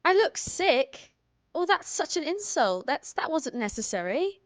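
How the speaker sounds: high, mock-offended British voice; British indignation, pitched high; fake British accent. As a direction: play insulted in a put-on British accent. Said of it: "I was British for fun".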